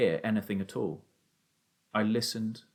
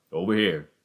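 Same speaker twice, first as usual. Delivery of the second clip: low-pitched answering call; 4 semitones lower, 4 dB louder than usual. A narrator's reading. Father guides son towards him, calling out. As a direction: call back low from a distance. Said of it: "the voice called out".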